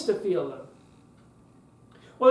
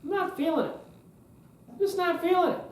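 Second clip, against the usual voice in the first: bored listener's thought; 7 semitones higher, 4 dB quieter than usual. Retold or imitated. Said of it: "and you're thinking".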